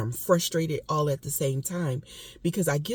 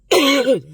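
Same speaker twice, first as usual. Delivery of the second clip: sharp real coughing fit, louder; asthma cough; 9 semitones higher, 11 dB louder than usual. Real, not acted. Vlogger coughing mid-rant from asthma, sharp loud cough. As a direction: cough hard as asthma flares. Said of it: "I'm sorry I did so much coughing".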